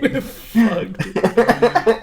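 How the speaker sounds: loud, high, cracking up; laughing despair. Real, not acted. Player sees the fight is lost, laughing despair. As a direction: blurt it out through real laughter.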